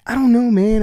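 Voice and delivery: loud, high I don't know, man; retold frustrated outburst. Agent venting about needing an education, loud frustration. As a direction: burst out in frustration.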